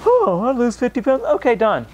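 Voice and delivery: high, airy mock voice; mock breezy. [name] mocking the idea weight loss was easy, airy. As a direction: toss it off as if losing weight were nothing. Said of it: "I was like".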